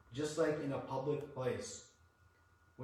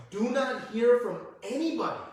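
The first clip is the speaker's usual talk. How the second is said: higher and louder stern warning; strong warning; 8 semitones higher, 8 dB louder than usual. Retold or imitated. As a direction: warn them firmly. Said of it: "he warned very strongly".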